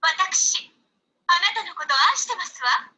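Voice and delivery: very high, proper Japanese lady voice; high-pitched anime lady's declaration. Shown as a demonstration. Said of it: "she sounds like this".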